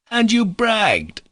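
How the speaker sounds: high, loud, drawn-out cry of reproach; cried out. A narrator's reading. Rakitin crying out at Alyosha, high and slow. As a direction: stretch it out in a high mocking cry. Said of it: "cried Rakitin".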